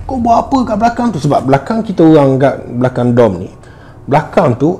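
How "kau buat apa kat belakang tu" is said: high, fast mock yell from afar; retold shout. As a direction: call out across the field, a touch alarmed. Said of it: "Acap tu menjerit". (jeritan olok-olok yang tinggi dan laju)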